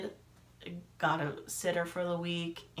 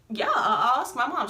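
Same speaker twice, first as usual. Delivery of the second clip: a touch of high-pitched fluster; acted flustered agreement; 5 semitones higher, 6 dB louder than usual. Telling friend she'll ask mom, mildly flustered. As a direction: re-enact agreeing in a hurry.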